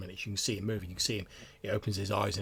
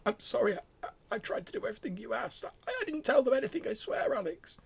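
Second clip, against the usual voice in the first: high, stammering tearful apology; crying a bit; faster than usual. Acted. Old patient apologises to the visitor, tearful. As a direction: sob it out, desperate to be believed. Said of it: "starts, like, crying a bit".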